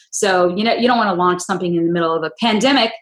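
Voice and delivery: ordinary talk, nothing marked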